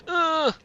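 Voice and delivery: a sharp, high, loud ugh of disgust; real frustrated ugh; frustrated groan. Real, not acted. Frustrated at bad item drop.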